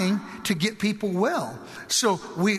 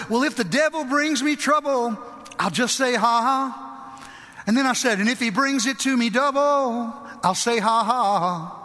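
high, chanted rhyme with fervor; rhythmic pulpit declaration; defiant preaching; 5 semitones higher than usual. Retold or imitated. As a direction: declare it boldly at the devil.

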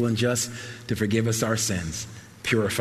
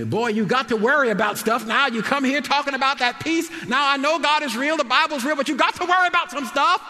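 high, louder mock scolding mama voice; imitating his mama; 4 dB louder than usual. Son imitating his sweet mama scolding him. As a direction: lecture the boy like a stern mother. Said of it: "She used to tell me".

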